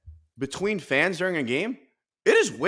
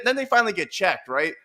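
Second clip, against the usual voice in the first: usual speaking voice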